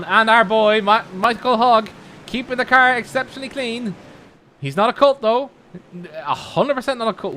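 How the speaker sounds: high, loud cheer for a favourite; excited commentary. Real, not acted. Commentator hailing their boy's clean driving, high and excited. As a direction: lift it high and proud, nearly calling out.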